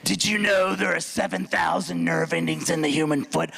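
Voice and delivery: low, slow smoker's drawl; mock chain-smoking masseuse. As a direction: rasp it out like a three-pack-a-day smoker. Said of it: "she sounded like she smoked".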